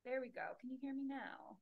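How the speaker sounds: faint, distant voice; barely audible. Real, not acted. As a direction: sound far away from the mic. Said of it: "Mm, just barely".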